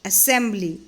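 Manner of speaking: usual speaking voice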